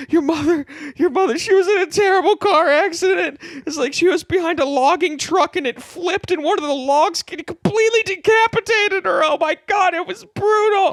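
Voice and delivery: high, loud, crying-laughing panic; hysterical dad. Acted. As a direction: wail the terrible news hysterically. Dad reports mom's death.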